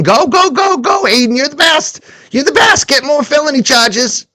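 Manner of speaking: very high, squealing mock cheer; mock cheerleader. Host mocking Pandora's cheering for Aiden, in a very high squealing voice. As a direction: ham up a gushing fan egging him on.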